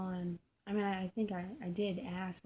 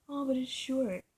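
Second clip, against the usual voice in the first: higher, louder mock whine; mock whining guy; 6 semitones higher, 7 dB louder than usual. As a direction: parody a guy sulking. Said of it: "you're like".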